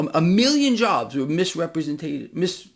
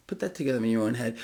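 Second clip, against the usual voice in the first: ordinary talk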